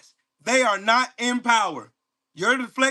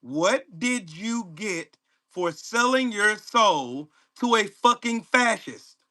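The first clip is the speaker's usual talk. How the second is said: slow, deliberate, word-by-word question; asking slowly; slower than usual. Real, not acted. Asking commenters who don't understand English, slowly. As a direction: draw out each word pointedly. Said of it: "Let me ask you slowly".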